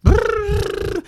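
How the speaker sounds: high buzzing lip trill. Retold or imitated. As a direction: blow a childish high brrr. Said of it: "I was in the back of the car going".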